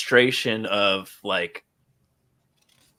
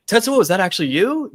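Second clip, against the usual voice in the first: pleading question; 8 semitones higher, faster than usual. Retold or imitated. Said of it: "he's like".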